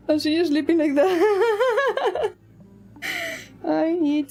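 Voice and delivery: high, slow laughing words. Real, not acted. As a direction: giggle through the words. Laughing at sleeping cat.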